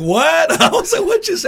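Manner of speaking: very high, loud mock shock; stunned disbelief. Onlookers after the umpire storms out, high-pitched astonishment. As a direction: squeal it like a baffled bystander.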